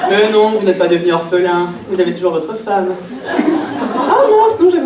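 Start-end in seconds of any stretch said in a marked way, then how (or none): 3.3-4.8 mock surprise. Sudden realisation on phone call.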